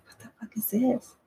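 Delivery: hushed, breathless whisper of disbelief; shocked whisper. Retold or imitated. Recalling her startled inner thought in meditation, whispered. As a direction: whisper it like you can't believe your eyes. Inner reaction to strange colors.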